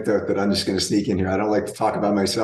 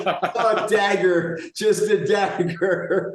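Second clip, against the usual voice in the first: higher, slow laugh through the words; laughing in mock pain; 9 semitones higher, much slower than usual. Real, not acted. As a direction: laugh as if stabbed by the remark. Son's put-down stings dad.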